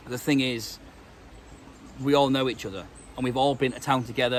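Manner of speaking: ordinary talk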